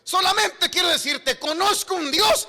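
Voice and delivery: shouted, high-pitched sermon climax; full-force preaching. Real, not acted. Preacher proclaiming Peter's miracle to the congregation, shouted fervent preaching. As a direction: thunder out the power of God.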